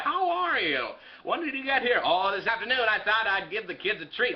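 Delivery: ordinary delivery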